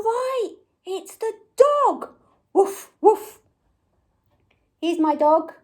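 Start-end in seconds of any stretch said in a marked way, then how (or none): 2.5-3.4 higher double woof; dog bark. Acted. Presenter imitating the dog for children, barking. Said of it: "And it goes".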